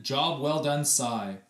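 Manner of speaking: ordinary delivery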